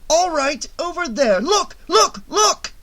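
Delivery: frantic spotting cry; urgent pointing. A narrator's reading. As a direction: jab each look at him. Pointing out the killer.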